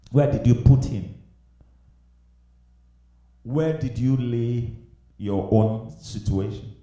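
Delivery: ordinary talk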